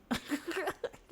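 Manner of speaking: sudden high-pitched giggle; high laugh. Real, not acted. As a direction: burst into a giggle. Laughing at own story.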